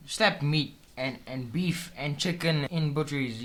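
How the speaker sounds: slow, spelled-out scolding; slow lecture. Shown as a demonstration. Friend slowly lecturing about butcheries, slow scold. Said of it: "slow lecturing".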